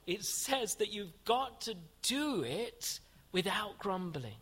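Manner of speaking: slowed down and weighted, ending on a falling "grumbling"; slow emphasis. Shown as a demonstration. Preacher insisting hospitality comes without grumbling, slow and weighted. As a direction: spell it out slowly so it sinks in. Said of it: "an emphasis on attitude".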